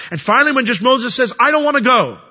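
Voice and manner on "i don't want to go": whiny refusal. Retold or imitated.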